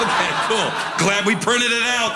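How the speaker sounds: mock-cheerful sarcastic reply, laughing. Husband answering his wife with laughing sarcasm. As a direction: toss it off with ironic cheer.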